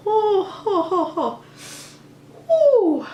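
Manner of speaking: high, breaking cries as tears come; real tears welling up mid-sentence; starting to cry. Real, not acted. Vlogger retelling her scare to viewers, breaking into real tears. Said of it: "I'm starting to cry".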